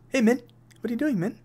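put-on high, friendly New Zealand accent. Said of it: "Why did he sound just like him".

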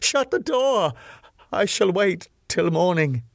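high desperate cry; trapped guest begging the Count, high and desperate; cried out. Acted. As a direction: shout it in panic, pitch flying up. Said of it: "as a last chance I cried out".

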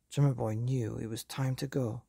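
ordinary delivery

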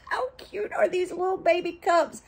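high cooing delight; high-pitched gushing. Real, not acted. Showing viewers little baby cups, high-pitched gushing.

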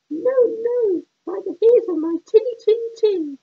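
high squeaky little pig. Acted. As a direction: squeal it like a scared piglet. Pig refuses the wolf.

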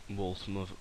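usual speaking voice

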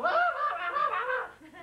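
high-pitched frenzied mock snarling; comic high yapping roar; imitated savage dog shake. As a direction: repeat wild dog growls as if shaking prey. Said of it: "It's going".

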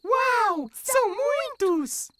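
high, slow amazed wow. Acted. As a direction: marvel at the candy haul. Child marvels at candy.

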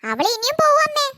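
put-on high tattling child voice; sing-song telltale kid; little sister voice.

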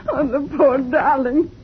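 ordinary talk, nothing marked

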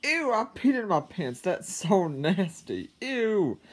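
high, loud, disgusted "ew" with a drawn-out "so nasty"; grossed-out squeal. Retold or imitated. Boy recalling his accident, squealing in disgust. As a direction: squeal it like wet pants are the grossest thing ever. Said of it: "something like this".

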